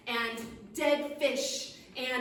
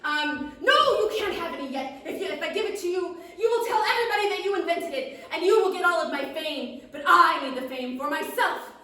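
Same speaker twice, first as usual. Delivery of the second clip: high, loud, grumbling gnome; 7 semitones higher, 8 dB louder than usual. Acted. Gnome confesses to the witch he hates serving steaks, high and loud. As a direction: complain loudly like a tiny food-hating gnome. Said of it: "he said it's".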